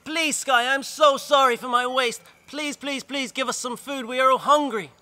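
high, loud desperate begging to the sky; girl begging the sky for forgiveness, high and loud; begging prayer. Acted. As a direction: plead upward with everything you have. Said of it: "she prayed and begged to the sky".

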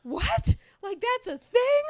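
high, loud incredulous outburst; shocked disbelief. Retold or imitated. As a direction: blurt it out in appalled surprise. Acts out own childhood shock.

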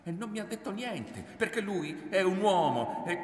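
high, rising, indignant voice; heated self-justification. A narrator's reading. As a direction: argue it loudly until cut off. Son defends himself, gets cut off.

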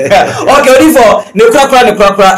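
loud, high excited call; excited outburst. Real, not acted. Excited host cuts in, calls prophet.

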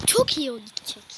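hushed, unvoiced whisper. Real, not acted. Boy whispering to himself as his player runs. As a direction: whisper it so nobody hears. Whispering while watching the play.

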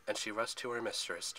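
plain reading voice

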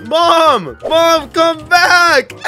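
put-on high, slow pleading child call; a kid crying out for mom to come back.

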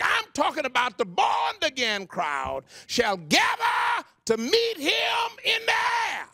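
high, shouted preaching with a cry in the voice; fervent revival preaching; preaching at full force. Acted. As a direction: preach it out to the crowd.

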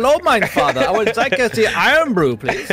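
put-on high, fast, prim little-boy voice with a stiff German lilt; mock child voice. Friend parodying the host's son asking his father for a drink, high mock child voice. Mocking the son's German-sounding talk.

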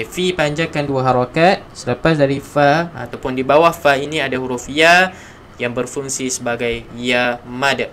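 ordinary delivery

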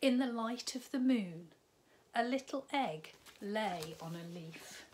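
lively storybook read-aloud; bright children's story narration. A narrator's reading. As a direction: tell it like a bedtime story to little ones. Reads picture book to children.

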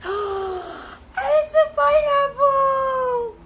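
gasp, then slow, high, amazed; drawn-out squeal of surprise, way up high; delighted surprise. Real, not acted. Surprised by the pineapple shape.

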